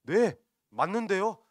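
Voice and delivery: high, loud, drawn-out retort; acted insolent reply; defiant back-talk. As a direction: throw it back brazenly. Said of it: "하면서 반항을 하고 있는 것입니다".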